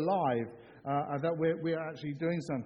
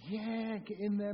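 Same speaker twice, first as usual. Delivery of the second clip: mock cheer; 4 semitones higher, slower than usual. Shown as a demonstration. Repeats the cheer to the congregation.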